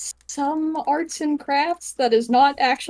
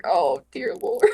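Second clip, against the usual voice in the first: exasperated groan; 9 semitones lower, slower than usual. Acted. Chip burying his face in his hands, low slow exasperation. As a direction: lower and slow, weary embarrassment.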